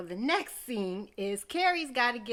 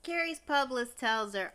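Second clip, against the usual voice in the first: ordinary delivery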